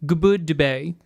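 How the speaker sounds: gubud day with ub before each vowel; Ubby Dubby speak. Shown as a demonstration. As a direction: say gubud like gub-ud. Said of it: "good day would be".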